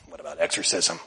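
quiet stunned repetition; incredulous echo. Retold or imitated. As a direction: echo it back, baffled. Echoes question back in disbelief.

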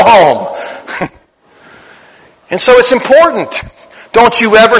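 loud, high, rousing praise; fervent preaching. Acted. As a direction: lift the room with fired-up praise. Preaching at full force.

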